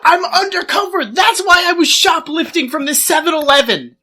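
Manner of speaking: high, loud mock desperation; over-the-top indignant pleading; hammed-up pleading shout. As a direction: beg an old friend loudly to vouch for you. Acting out pleading when arrested.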